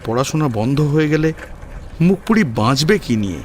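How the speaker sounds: ordinary delivery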